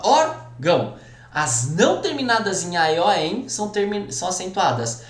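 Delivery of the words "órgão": "Órgão" with ÓR punched, then a pause before gão; stressed syllable split off. Shown as a demonstration. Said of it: "A sílaba tônica é a penúltima". ("Órgão" separado, destacando o ÓR)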